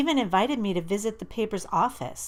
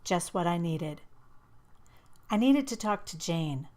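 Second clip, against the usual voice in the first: plain reading voice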